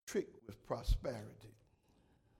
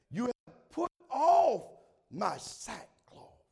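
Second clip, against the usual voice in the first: preaching; 8 semitones higher, 10 dB louder, much slower than usual. Acted. Preaching at full force.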